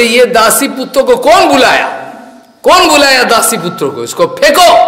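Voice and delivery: loud, high outraged demand; angry Duryodhan. Retold or imitated. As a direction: fire it out like an enraged prince. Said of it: "गुस्सा आया".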